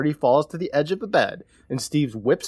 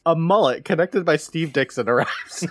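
ordinary delivery

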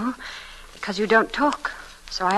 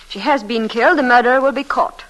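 insistent; 5 semitones higher, 6 dB louder than usual. Acted. Insisting on the murder.

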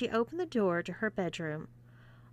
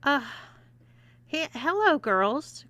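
shaky, stammering hello; nervous croak; 7 semitones higher, 6 dB louder than usual. Acted. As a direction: stammer it out, scared. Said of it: "croaked Eric".

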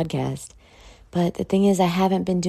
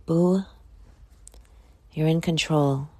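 normal talking voice

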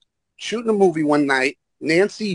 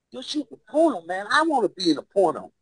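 high, softer starstruck voice; eager kid impression; 6 semitones higher, 5 dB quieter than usual. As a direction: imitate an excited young installer. Acts out eager installer kid.

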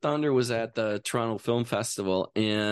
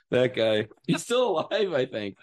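usual speaking voice

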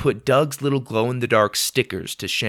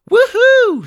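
very high, loud whoop; shouted cheer; 10 dB louder than usual. A narrator's reading. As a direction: whoop for a teammate's basket. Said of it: "shouted Lydia".

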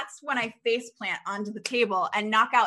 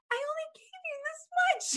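much higher, a startled mock protest; incredulous friend. Friend reacting to the cough syrup, pitched high and startled. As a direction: squeak it like a shocked friend. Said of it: "And she said".

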